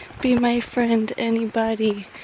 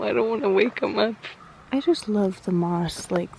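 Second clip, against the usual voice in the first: usual speaking voice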